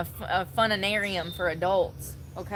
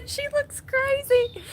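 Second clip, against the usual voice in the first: high, slow put-on voice of shocked students; high, drawn-out student voice; mocking students; 5 dB louder, slower than usual. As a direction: squeal it like stunned kids. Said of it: "all them students was like".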